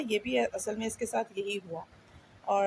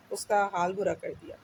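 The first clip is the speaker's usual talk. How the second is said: ordinary talk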